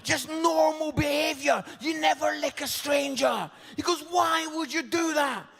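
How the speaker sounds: son's outraged telling-off; exasperated son. Retold or imitated. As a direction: tell dad off in disbelief. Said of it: "He's like".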